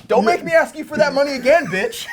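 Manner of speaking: loud, high scolding; mock berating. Club boss berating a male stripper, loud mock scolding. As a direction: act out chewing someone out over money. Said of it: "it was basically like".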